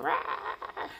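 mock cat attack growl; a quick playful cat snarl; voicing the cat's swipe; cat hiss. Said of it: "she'll be like".

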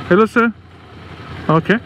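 normal talking voice

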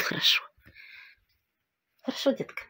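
tender whisper, rising at the end; шёпот. Real, not acted. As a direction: whisper reassurance to a dog. Шепчет собаке. (нежный шёпот собаке)

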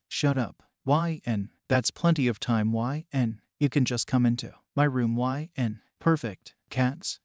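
ordinary delivery, nothing marked

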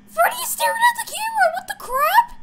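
very high, fast squeal of fright; panicked shriek. Real, not acted. Player stared at by Freddy, high panicked shriek. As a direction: squeal it as panic takes over.

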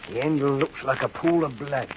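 low, hushed wonder; entranced murmur. Acted. Henry to Albert over the glowing knife, hushed and entranced. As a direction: speak softly, drawn in by the stone.

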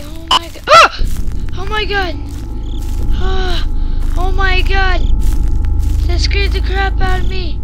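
loud startled shrieks; jump scare. Real, not acted.

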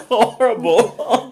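slow, drawn-out laughing groan. Real, not acted. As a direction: stretch it out while laughing. Tasting awful candy, laughing.

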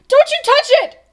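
piercing high shout; shouted warning. A narrator's reading. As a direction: yell urgently to stop a hand. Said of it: "shouted Bartholomew".

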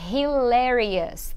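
"Hilarious" spoken slowly, syllable by syllable, for repeating; slow it down so students can copy "Hilarious"; slow model word. Shown as a demonstration. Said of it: "em inglês eu posso dizer hilarious". ("Hilarious" bem devagar, para os alunos repetirem)

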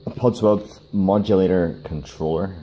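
careful, deliberate pace on the tricky name; slow, careful naming of the part; said slowly. Real, not acted. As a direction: spell out Podsworth modulator controller carefully. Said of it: "I have to say that slowly".

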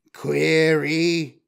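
machine voice, rasped and questioning; rasped robot query. A narrator's reading. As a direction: rasp it like a robot. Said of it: "U R O two five rasped".

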